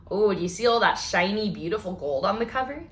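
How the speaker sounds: ordinary delivery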